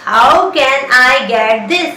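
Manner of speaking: very slow, stretched. Shown as a demonstration. Teacher drilling the question very slowly. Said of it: "English में बोलिए".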